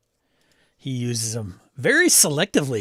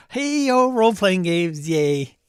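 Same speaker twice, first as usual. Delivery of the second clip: mock cheer; 6 semitones higher, 3 dB louder, slower than usual. Mock cheer for house rules.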